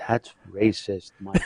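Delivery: low, soft, slow scolding; a hushed little voice in the head; quiet inner voice. Retold or imitated. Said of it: "the little man in my head said".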